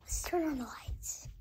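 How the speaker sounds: soft sneaking whisper. Real, not acted. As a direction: whisper so hiders can't hear.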